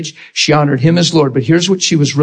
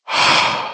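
acted breathy sigh, no voice; 4 dB quieter than usual. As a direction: let out a sigh as if moved. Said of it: "he went".

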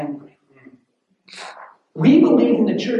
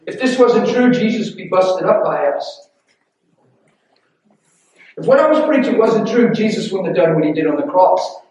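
rising preaching; 2 semitones higher, 6 dB louder than usual. Acted. Preaching builds.

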